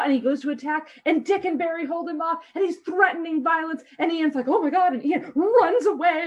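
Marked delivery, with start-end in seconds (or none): 4.5-4.9 mock scared. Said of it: "and he's all scared".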